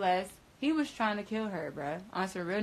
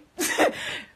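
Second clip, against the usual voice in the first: airy unvoiced laugh, much higher; breathy high laugh; 7 dB louder than usual. Real, not acted. Laughing that mama knows best, airy laugh. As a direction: let a short airy laugh escape.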